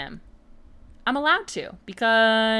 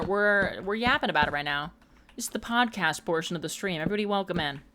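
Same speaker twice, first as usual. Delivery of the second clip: ordinary talk, nothing marked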